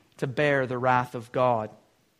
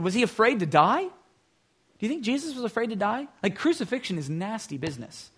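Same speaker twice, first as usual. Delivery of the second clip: normal talking voice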